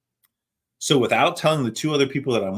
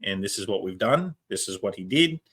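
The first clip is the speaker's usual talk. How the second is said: Australian paramedic giving a report; Australian accent. Retold or imitated. Paramedic reporting to nursing staff in an Australian accent. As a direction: give the handover in an Australian accent. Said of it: "in the Australian accent".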